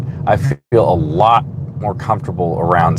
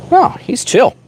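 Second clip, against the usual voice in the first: surprised; 4 dB louder than usual. Real, not acted. Snake handled calmly.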